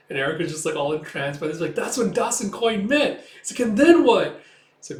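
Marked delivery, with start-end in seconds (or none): 1.8-3.3 high, louder, eager burst; excited interjection. Retold or imitated. Erica entranced by the story, excited interjection. 3.6-4.4 high, loud, excited question; eager demand. Retold or imitated. Erica entranced by the story, eager demand.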